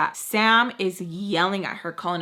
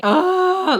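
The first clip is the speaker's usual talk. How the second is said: high frustrated ugh; frustrated groan; 11 semitones higher, 8 dB louder than usual. Retold or imitated.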